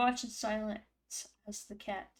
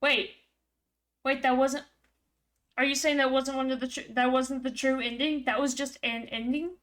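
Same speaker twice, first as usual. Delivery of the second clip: louder, higher, disbelieving; surprised outburst; 3 semitones higher, 6 dB louder than usual. Real, not acted. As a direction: caught off guard by the ending. Shocked it was not true ending.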